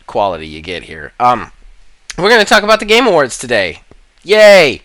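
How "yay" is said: loud, high yay; cheer. Real, not acted. Host cheers for the Game Awards topic, loud and high.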